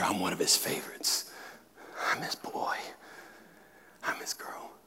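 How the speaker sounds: soft unvoiced whisper; whispered assurance. Preacher voicing the believer's heart, in a whisper. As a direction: breathe it like a secret.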